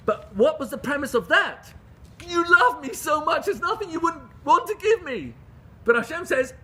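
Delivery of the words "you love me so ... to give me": loud, much higher-pitched fervent declaration; impassioned appeal. Retold or imitated. A believer addressing God's boundless love, impassioned. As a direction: appeal with full fervour, high and loud. Voicing the premise of begging God.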